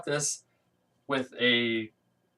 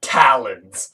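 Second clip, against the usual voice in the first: gloating; 6 dB louder, much slower than usual. Retold or imitated. Bane gloating at discovery.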